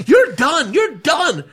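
high, loud mock yell; imitated screaming. The chaperone screaming at the gangsters, in a high loud mock yell. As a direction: shout her down like the chaperone. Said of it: "Just screaming".

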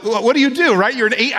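normal talking voice

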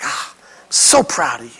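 low, soft doting praise; hushed, tender parental pride; proud parent. Retold or imitated. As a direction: murmur it like a beaming parent. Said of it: "It's like".

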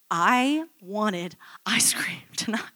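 slow, breathy mock-pouting whisper with a laugh; whiny whisper. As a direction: whimper it like a sulking child, giggling. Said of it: "And I was like".

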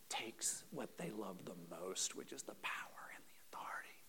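low, very soft near-whisper; hushed emphasis. Real, not acted. Preacher exposing the leaders' love of power, hushed. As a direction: let the voice drain away almost to nothing. Preacher drops voice on key point.

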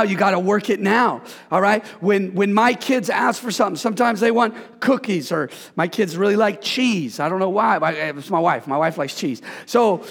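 usual speaking voice

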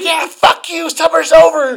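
high, loud imitated rage; retold angry yelling. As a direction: shout it back as the raging customer. Retells customer still raging.

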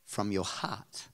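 "from your heart" set apart, slow, low and soft; emphasis on the phrase. Real, not acted. Preacher stressing how to forgive brother and sister, slow soft emphasis. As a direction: weigh "from your heart" slowly and quietly. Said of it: "That's the emphasis".

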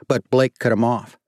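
usual speaking voice

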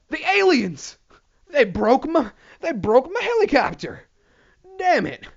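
mock panicked hobbyist. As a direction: wail it like a man whose toy just fell. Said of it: "It's like".

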